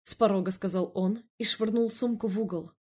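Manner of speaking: plain reading voice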